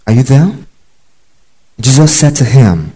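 usual speaking voice

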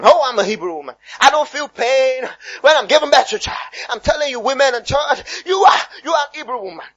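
high, hammed-up church exhortation; strained, over-passionate declaration; mock fervent preacher. Mimicking a preacher telling women they're Hebrew women, hammed-up fervour.

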